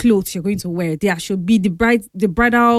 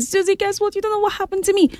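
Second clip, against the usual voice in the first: high-pitched, excited phone greeting; bubbling to share news, pitched way up; 12 semitones higher, 3 dB quieter, faster than usual. Retold or imitated. Retelling a friend's call to announce news, excited and high. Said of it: "She was sounding excited".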